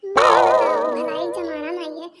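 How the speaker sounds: loud, very slow indignant complaint. Acted. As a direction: drag it out with wounded pride. Wife hurt by teasing, scolding. (आहत होकर धीमे, ज़ोर से शिकायत)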